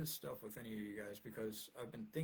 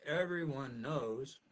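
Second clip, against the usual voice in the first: slow, louder sarcastic everyone knows; 3 semitones higher, 7 dB louder, much slower than usual. Acted. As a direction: say it dripping with sarcasm. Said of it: "so I'm using that sarcastically".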